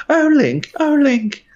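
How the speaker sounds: woeful high-pitched princess voice acting; Zelda. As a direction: plead in a hammed-up, very high heroine voice.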